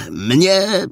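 high, overwhelmed blurt; joyful emotion. A narrator's reading. Boy blurts to the officer, overcome with joy. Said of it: "wyrąbał ogarnięty radosnym wzruszeniem".

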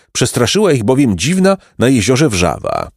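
ordinary delivery

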